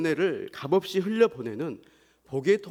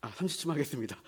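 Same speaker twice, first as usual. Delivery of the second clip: out of breath; 7 dB quieter, faster than usual. Real, not acted. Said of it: "제가 숨이 차서".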